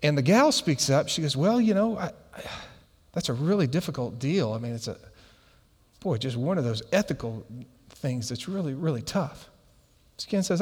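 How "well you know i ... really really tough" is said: soft, halting, dropping lower; imitating someone uneasy, trailing into softness; hesitant. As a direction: feel awkwardly for an answer, quietly. Voices the uncomfortable woman's hesitant answer.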